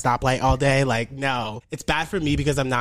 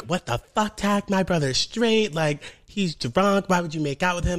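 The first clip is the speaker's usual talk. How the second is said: mock yelling; 6 semitones higher than usual. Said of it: "she is yelling at me".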